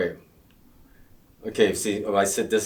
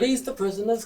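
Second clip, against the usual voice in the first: British accent. Shown as a demonstration. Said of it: "he's British".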